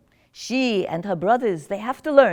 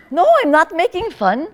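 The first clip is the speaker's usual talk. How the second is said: laughing denial; 7 semitones higher, 8 dB louder than usual. Acted. Teasing denial repeated.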